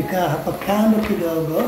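normal talking voice

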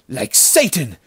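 high, loud, alarmed echo; frightened babble. A narrator's reading. Other bandit echoing the dying words, panicked babble. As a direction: repeat it in sudden terror. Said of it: "babbled the other bandit".

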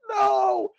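high-pitched drawn-out "no"; mock-panicked refusal; alarmed parent. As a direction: cry out to cut the kids off. Parent cutting off the kids.

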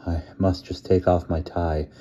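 ordinary delivery, nothing marked

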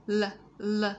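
"L" as a short "luh"; two separate "luh" sounds for "L"; phonics sound. Shown as a demonstration. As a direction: sound out "l" as a letter sound, not its name. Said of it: "Phần này là phần phonics".

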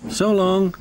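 smiling, high farewell; brave. A narrator's reading. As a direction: put on a brave smile. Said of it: "smiled Oliver bravely".

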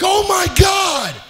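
very high, slow, loud scream of panic; high stretched-out frightened yell; terrified shriek. Retold or imitated. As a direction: shriek it as the horse bolts. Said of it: "So I'm like".

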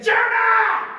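big high shout of the name; shouting Jonah. Acted. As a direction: yell his name across the deck. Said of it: "And they said".

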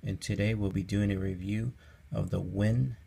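usual speaking voice

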